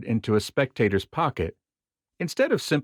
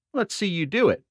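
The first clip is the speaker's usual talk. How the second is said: challenging spectator; 7 semitones higher than usual. Retold or imitated.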